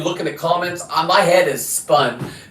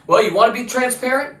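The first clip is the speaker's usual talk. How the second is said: raised-pitch challenge thrown back; challenging retort; 7 semitones higher than usual. Acted. Answering officials who promised transparency, challenging rising question. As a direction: turn their promise into a pointed question.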